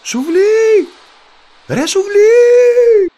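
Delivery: very high, loud holler, stretched at the end; shouting. A narrator's reading. Boy calls the third fish, escalating high shout. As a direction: yell the name across the sea, drawing it out. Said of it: "θυμήθηκε και φωνάζει".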